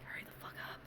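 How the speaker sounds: hissed whisper under the breath; impatient whisper. Retold or imitated. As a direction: urge them on without being heard. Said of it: "I was just standing there like".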